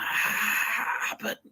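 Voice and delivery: high, breathy, fading to a whisper; panicked high cry. Retold or imitated. Frightened man insisting on his real parents.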